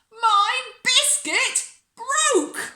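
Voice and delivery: high, loud, very slow wail; tantrum wail. A narrator's reading. Bella's biscuit breaks at lunch, wailing in a tantrum. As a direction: wail each word as a small disaster.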